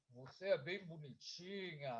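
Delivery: soft, low, strange voice; creepy uncle's compliment, low and hushed; voz estranha. Acted. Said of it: "Com uma voz estranha". (voz estranha, baixa e mansa)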